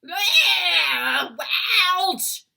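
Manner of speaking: loud giant yelps of pain; pained roar. A narrator's reading. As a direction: howl in pain and disgust. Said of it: "roared the blood bottler".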